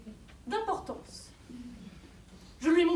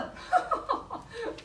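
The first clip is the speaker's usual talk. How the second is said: scornful laugh; 6 semitones higher, 5 dB quieter than usual. Acted. Mocking laugh at accusation.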